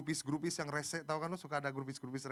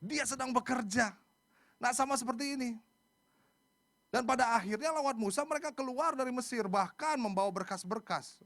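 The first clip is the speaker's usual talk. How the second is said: high, raised pulpit voice; fervent preaching; 8 semitones higher, 6 dB louder than usual. Real, not acted. Preacher assuring congregation God is at work, fervent. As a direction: proclaim it with conviction to the crowd.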